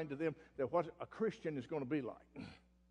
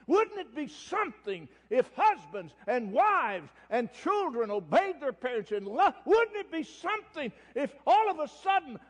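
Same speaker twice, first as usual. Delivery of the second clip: rising, impassioned preacher's plea, higher and louder; fervent preaching; 5 semitones higher, 4 dB louder than usual. Acted. Preacher pleading with his congregation, impassioned and rising. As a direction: preach it with mounting fire.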